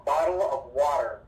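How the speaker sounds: American BOTTLE OF WATER, very strong final R; firm American accent, every consonant sounded in BOTTLE OF WATER. Shown as a demonstration. Teacher contrasting with British for students, strong American accent. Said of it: "In America, we make it very, very strong".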